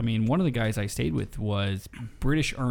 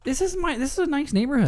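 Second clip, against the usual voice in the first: indignant insistence. Real, not acted. Defending his neighborhood.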